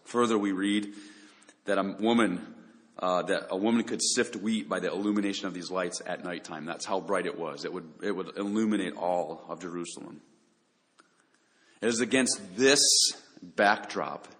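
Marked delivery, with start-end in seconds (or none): none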